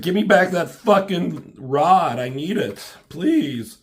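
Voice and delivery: high, loud mock begging for the rod, then easing; desperate loud plea. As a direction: demand it loudly, then plead. Wolverine begs for the rod.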